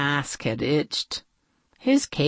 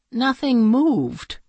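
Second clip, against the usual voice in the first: plain reading voice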